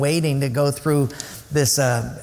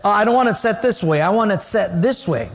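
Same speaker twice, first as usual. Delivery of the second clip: raised voice, insisting on one way; bossy insistence; 6 semitones higher, 3 dB louder than usual. Retold or imitated. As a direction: act out someone laying down the rules. Acting out bossy chair-setting instruction.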